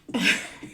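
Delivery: brief stifled chuckle; short, real, breathy chuckle; laugh. Real, not acted. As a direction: let a small laugh slip out mid-thought. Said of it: "I don't know why I'm laughing".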